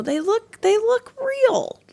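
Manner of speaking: high, slow gushing; drawn-out amazed praise. Real, not acted. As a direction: stretch it out in awe.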